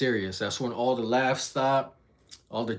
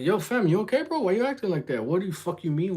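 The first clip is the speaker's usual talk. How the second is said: puzzled concern; 7 semitones higher than usual. Retold or imitated. Ryan checks on hurt kid.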